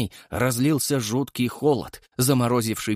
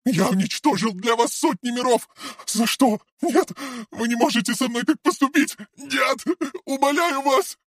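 desperate sobbing plea; 13 semitones higher than usual. A narrator's reading. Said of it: "Мария сорвалась на рыдания".

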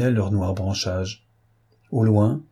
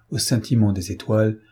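ordinary delivery

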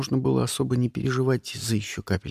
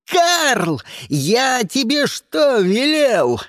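high, loud, drawn-out rebuke; angry scolding; 9 semitones higher, 4 dB louder, much slower than usual. A narrator's reading. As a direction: berate your henchman in a yell. Lars angrily scolds his henchman. (гневно отчитывает, срываясь на крик)

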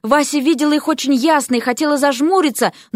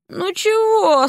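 much higher, thin, puzzled voice; startled reedy little voice; strangely thin voice; 9 semitones higher, much slower than usual. A narrator's reading. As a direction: squeak it out, confused and half awake. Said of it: "странно тонким голосом".

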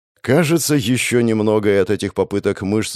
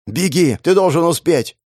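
urgent shout, higher pitch; 7 semitones higher than usual. A narrator's reading. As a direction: yell it with desperate urgency. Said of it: "кричит мне Валип".

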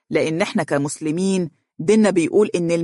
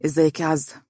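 reluctant. A narrator's reading. Young man forcing himself to greet her, reluctant. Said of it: "بسرعة وكأنه يجبر نفسه".

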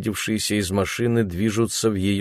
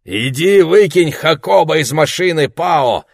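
loud, higher barked order; commanding; 8 semitones higher, 7 dB louder than usual. A narrator's reading. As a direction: bark the order at him. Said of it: "распорядился мужчина".

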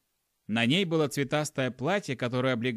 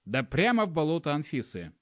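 plain reading voice

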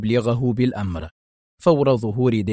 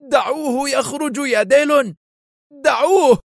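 much higher, desperate wail; collapsing terror; 13 semitones higher than usual. A narrator's reading. Ventura, near collapse, begs Dillon to let him go. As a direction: beg as if about to break down. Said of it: "وهو يكاد ينهار رعبا".

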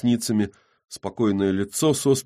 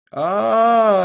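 long high loud yell; yelling aaah; 14 semitones higher, 8 dB louder than usual. Acted. Zheka yells long and loud while beating the dog. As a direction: let out a long fighting yell. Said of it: "орёт Жека".

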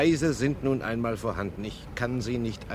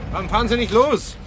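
much higher and louder, pressing; urgent call; 10 semitones higher, 7 dB louder, faster than usual. Acted. Detective urges the wife to drive off, calling out loudly. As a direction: hurry her along.

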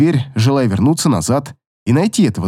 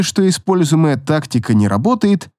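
ordinary delivery

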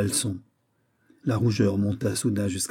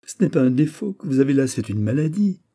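amused exclamation; 6 semitones higher, 5 dB louder than usual. A narrator's reading. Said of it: "se mit à rire".

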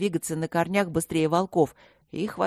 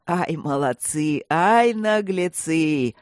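booming guffaw; much slower than usual. A narrator's reading. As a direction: bellow it while laughing hard. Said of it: "громогласно ржал дракон".

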